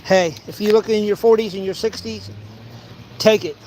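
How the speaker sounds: ordinary talk, nothing marked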